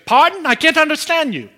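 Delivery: high, loud puzzled Indian-accented imitation; Jerry, Indian accent. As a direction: imitate a baffled Indian phone minister. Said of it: "precious little Indian guy named Jerry".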